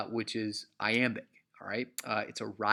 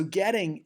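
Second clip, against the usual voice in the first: getting high and loud on the first syllable; GET-ting, falling away; trochaic stress on GET; 7 semitones higher, 5 dB louder than usual. Shown as a demonstration. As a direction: punch the start of getting. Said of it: "is a trochaic word".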